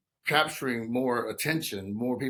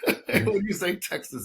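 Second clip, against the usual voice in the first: higher, laughing through the words; 8 semitones higher than usual. Real, not acted. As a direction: chuckle as you say Texas. Laughing about Texas reaction.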